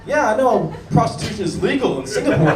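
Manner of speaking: usual speaking voice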